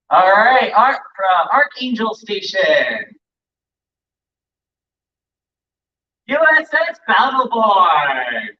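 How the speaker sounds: slow, drawn-out ship announcement; announcer roll call. Acted. Emcee announcing chapters at the parade, slow announcer call.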